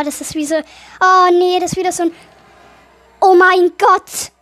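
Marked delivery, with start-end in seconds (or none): 3.2-4.3 slow, loud startled gasp of words; jolted by a jump scare. Real, not acted. Gamer startled by a scare, slow loud exclamation. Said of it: "Habe ich mich erschrocken".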